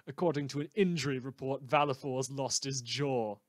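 ordinary talk

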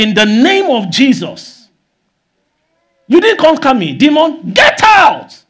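loud, high shout, climbing to the last words; commanding rebuke. Acted. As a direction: cast out a demon with full authority. Acts out rebuking a demon.

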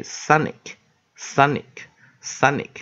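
slow, separate model readings of sonic; pronunciation demo. Shown as a demonstration. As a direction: say sonic slowly, three times, for learners to copy. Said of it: "可以念". (慢速示范 sonic 的读音)

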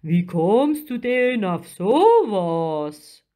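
slow, swooping outraged giant; drawn-out offended creature voice; loud, big and affronted; indignant. A narrator's reading. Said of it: "rief der Krake empört".

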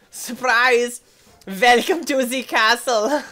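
put-on loud, high German-accented welcome; hammed-up German host, slow and loud; mock German accent. Plays German castle host again.

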